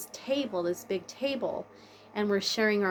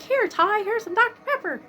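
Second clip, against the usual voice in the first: much higher, bright sing-song offer; cheery mom voice; 14 semitones higher, 6 dB louder than usual. Retold or imitated. Mom in her dream giving son Tyler a Doctor Pepper, high and cheery. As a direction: hand your son his favorite soda.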